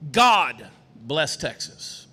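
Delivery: "God" stretched long and set apart, "bless Texas" dropping low; stress on GOD. Shown as a demonstration. Candidate showing the crowd how emphasis shifts meaning, stress on "God". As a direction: lean hard on "God", then let "bless Texas" fall away. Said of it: "put emphasis on any word in that statement".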